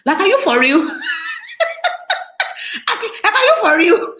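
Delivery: high, loud, laughing disbelief; incredulous laughter. Retold or imitated. Woman reacting to an insulting money question, laughing incredulously.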